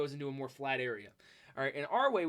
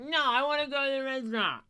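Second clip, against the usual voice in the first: higher, louder whiny insistence; drunk mom insisting; 7 semitones higher, 5 dB louder than usual. Retold or imitated. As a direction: mimic the drunk mom demanding the restaurant. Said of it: "she's like".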